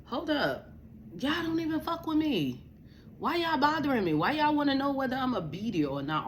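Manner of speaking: high-pitched, exasperated protest; indignant retort. Real, not acted. Telling nosy family to stop bothering her, indignant high pitch. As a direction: push back in a high, incredulous voice.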